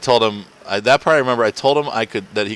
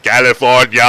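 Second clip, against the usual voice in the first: California said in a mock Austrian accent, louder; Arnold Schwarzenegger accent; 3 semitones higher, 7 dB louder than usual. Retelling a comedian's bit, California in mock Austrian accent. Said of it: "Arnold Schwarzenegger is not able to impersonate".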